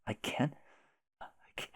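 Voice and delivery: breathless whisper, voice failing; an unvoiced whisper of grief. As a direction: whisper it, too upset to say it. Too upset to type name.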